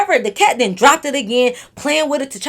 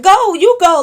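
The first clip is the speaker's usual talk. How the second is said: louder, higher mock shove-along; pushy urging; 7 semitones higher, 9 dB louder than usual. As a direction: act out egging someone on from behind. Voices urging someone while pushing.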